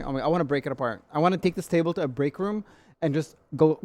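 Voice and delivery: usual speaking voice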